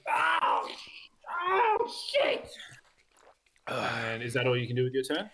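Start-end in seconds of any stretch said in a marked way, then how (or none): 0.1-2.5 high shrieking goblin panic; panicked goblin yelp. Acted. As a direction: squeal it like a scared goblin. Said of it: "The goblin goes".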